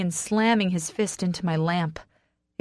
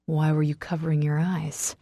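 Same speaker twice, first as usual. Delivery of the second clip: usual speaking voice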